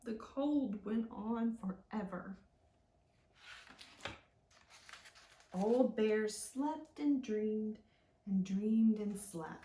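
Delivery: put-on slow, gentle read-aloud for kids; storybook reading.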